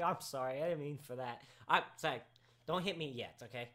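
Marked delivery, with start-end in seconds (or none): none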